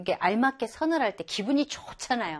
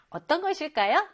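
usual speaking voice

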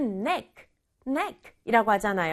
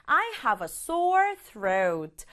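slow model sentence; much slower than usual. Shown as a demonstration. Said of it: "대답도 해볼게요".

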